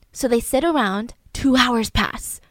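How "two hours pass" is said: ordinary delivery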